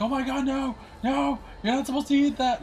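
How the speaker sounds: put-on high, loud panicked old lady; panicked owner. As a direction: shriek it like a frantic dog owner. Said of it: "She's like".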